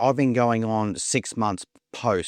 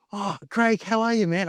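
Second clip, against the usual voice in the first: high, bright-eyed surprised hello; surprised greeting; 10 semitones higher than usual. Retold or imitated. Surprised to run into Craig, high excited greeting. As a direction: greet an old mate you didn't expect.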